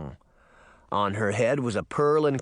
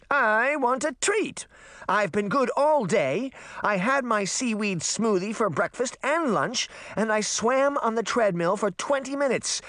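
high-pitched, insistent royal whine; whining spoiled princess; 10 semitones higher than usual. Acted. Princess insists she has earned a treat, high whine. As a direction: plead your case like a spoiled princess.

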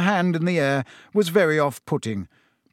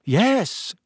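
uncertain; 8 semitones higher than usual. A narrator's reading.

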